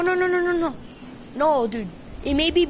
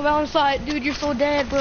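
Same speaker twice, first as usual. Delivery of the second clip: ordinary talk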